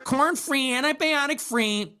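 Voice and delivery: high, mocking list of food labels; mock influencer. Comic mocking the influencer's buzzword list. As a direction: sneer at the grocery buzzwords.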